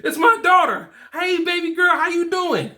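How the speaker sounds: put-on high, bright greeting to his little girl; light up hearing your baby girl's voice; excited. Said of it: "I'm ex-, so excited".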